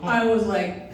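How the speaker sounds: ordinary talk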